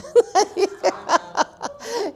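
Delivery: high, loud burst of laughing; real laughter. Real, not acted. Mother laughing about her ornery son, loud laughter. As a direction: break up laughing.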